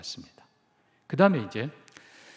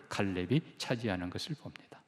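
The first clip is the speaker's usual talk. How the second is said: whispered; 6 semitones lower, 7 dB quieter than usual. Real, not acted. Climax line, whispered.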